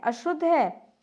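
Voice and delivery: usual speaking voice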